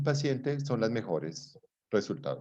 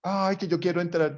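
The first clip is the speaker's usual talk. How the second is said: put-on much higher, childish whine; whiny man; 6 semitones higher than usual. Imita al señor caprichoso. (el señor quejándose con voz aguda de capricho)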